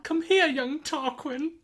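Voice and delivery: high, slow, loud mock mum voice; hammed-up imitation of his wife; imitating Grace's voice. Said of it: "that's me doing your voice".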